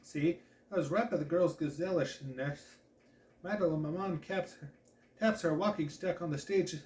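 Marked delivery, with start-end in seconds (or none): none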